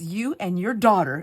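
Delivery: loud, slow accusing address; pointed, playful reproach; mock scolding. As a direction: imitate an exasperated husband. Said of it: "we talk like, like this".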